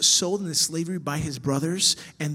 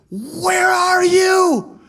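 much higher, loud, slow anguished shout; David crying out; 13 semitones higher, 11 dB louder, much slower than usual. Acted. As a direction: shout it to the sky in despair. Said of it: "You look at David".

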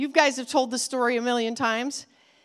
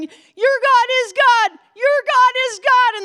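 high, loud proclamation; crowd crying out; 9 semitones higher, 7 dB louder than usual. Acted. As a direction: cry it out in awe.

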